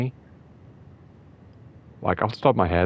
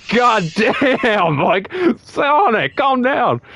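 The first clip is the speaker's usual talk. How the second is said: much higher, slow, drawn-out disbelief; stunned exclamation; 4 dB louder, much slower than usual. Retold or imitated. Podcaster reacting to Sonic's savage tweet, stunned. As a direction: gasp it out like you can't believe the tweet.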